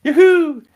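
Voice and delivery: high joyful whoop, loud; happy yay. Pretend. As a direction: cheer like a yay sound effect. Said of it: "if you wanna do a sound effect yourself".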